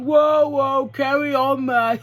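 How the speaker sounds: high, loud put-on British accent; mock British accent. Wrestler mocking her British rival, exaggerated British accent. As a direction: imitate an Austrian doing a weird British accent. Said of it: "She puts a weird fucking British accent on".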